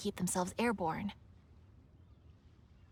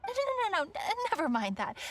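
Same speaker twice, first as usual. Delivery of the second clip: flustered backpedal; 8 semitones higher than usual. Acted. Catches herself rambling about prices.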